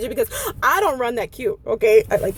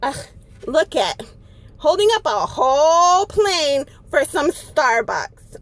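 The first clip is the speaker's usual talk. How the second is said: slow, drawn-out disgusted griping; mocking complaint; slower than usual. Lady complaining about a held-up plane, disgusted. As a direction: drag it out in mock disgust. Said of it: "But she's like".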